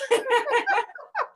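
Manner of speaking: a high bright laugh. Real, not acted. Laughing at herself.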